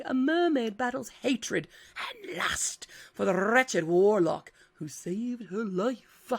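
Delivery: slow, hushed, dramatic whisper; sultry whisper. Acted. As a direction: breathe the blurb out slowly. Dramatic reading of blurb.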